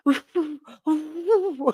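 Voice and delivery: breathy high ooh instead of a whistle; failed whistle. Retold or imitated. Facing the bear without a whistle, failed whistle ooh. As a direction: try to whistle and only blow ooh. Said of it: "I was trying to whistle that bear away".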